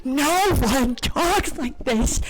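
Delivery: high, loud, incredulous rant; exasperated outburst. Real, not acted. Fed up with the dialogue, loud exasperated disbelief. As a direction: cry out in disbelief, stretching the words. Outraged reaction to bad dialogue.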